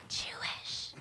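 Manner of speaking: hushed stage whisper of the punchline. Acted. Host whispering the founder's religion as a punchline. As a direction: drop to a secret whisper.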